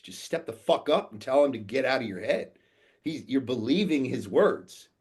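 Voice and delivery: retold tough-love pep talk, pushing hard; forceful confrontation. Retells confronting his client forcefully.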